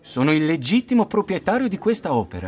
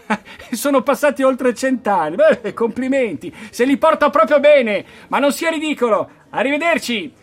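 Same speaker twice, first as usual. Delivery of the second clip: scornful mockery; 8 semitones higher, 4 dB louder than usual. Scoffing at the old man.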